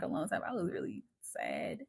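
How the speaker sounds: a breathless whisper, as a secret to the listener; whispered aside. Real, not acted. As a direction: lean in and whisper "I was really sad". Whispered aside after a laugh.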